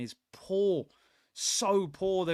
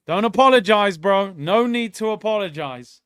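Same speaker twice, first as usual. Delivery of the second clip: high, loud, no need to say sorry, bro; shouted back at a chat message; hyped loud answer; loud reply; 4 semitones higher, 10 dB louder than usual. Real, not acted. Replying loudly to a comment.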